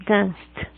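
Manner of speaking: "Danced" as one syllable, danst; "Danced" with a silent e. Shown as a demonstration. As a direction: end "Danced" on s plus a released t. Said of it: "suena como una T".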